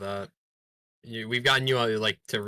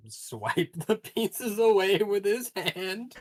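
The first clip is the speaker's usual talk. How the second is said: laughing; 11 semitones higher, much slower than usual. Real, not acted. Describing his action, laughing.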